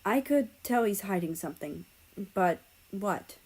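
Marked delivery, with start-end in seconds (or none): none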